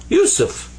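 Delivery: Yusuf sung out high and falling; proud name. Shown as a demonstration. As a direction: announce Yusuf with a bright high lift. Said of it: "And that's my name".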